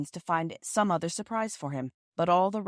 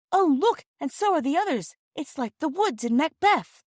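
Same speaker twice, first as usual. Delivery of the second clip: much higher, louder, astonished; amazed cry; 10 semitones higher, 6 dB louder than usual. Acted. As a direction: gasp out the wonder of it. Bobby amazed by moving trees.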